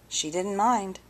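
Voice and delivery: ordinary delivery, nothing marked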